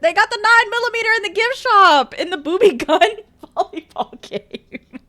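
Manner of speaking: high, laughing through words. Real, not acted. Laughing while reading.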